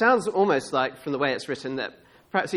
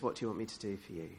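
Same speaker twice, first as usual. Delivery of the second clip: soft, low, quick question; hushed, low-key offer; Jesus speaking gently; 8 semitones lower, 13 dB quieter, much faster than usual. Acted. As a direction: ask it quietly and without fuss. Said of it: "And Jesus says".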